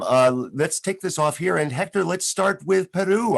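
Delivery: normal talking voice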